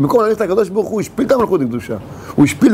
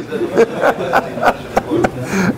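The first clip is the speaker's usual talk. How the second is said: amused chuckle. Real, not acted. Laughing at own joke.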